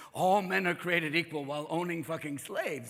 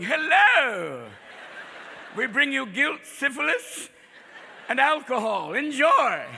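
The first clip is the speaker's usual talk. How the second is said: high, mock-cheery hail; Puritan settlers' greeting; 5 semitones higher, 5 dB louder, slower than usual. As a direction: call out grandly to new neighbours. Puritans greeting natives on landing.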